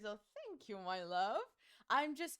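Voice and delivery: ordinary talk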